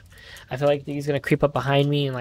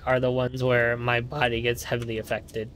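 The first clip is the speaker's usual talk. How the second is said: ordinary delivery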